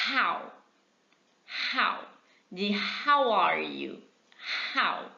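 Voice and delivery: how said slowly as "hau"; model pronunciation. Shown as a demonstration. Teacher modelling how for students, slow and clear. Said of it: "vamos pronunciar comigo".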